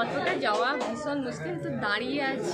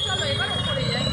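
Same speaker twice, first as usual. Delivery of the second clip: raised voice over the crowd; loud over crowd noise; 6 dB louder than usual. Real, not acted. Said twice, loud then plain. (ভিড়ের মধ্যে জোরে)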